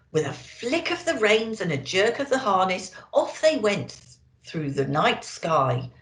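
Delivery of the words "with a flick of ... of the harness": usual speaking voice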